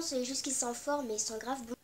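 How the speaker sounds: normal talking voice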